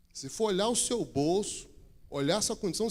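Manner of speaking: usual speaking voice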